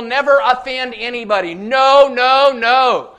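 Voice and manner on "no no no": emphatic refusal. Real, not acted. Rejecting crowd-pleasing church.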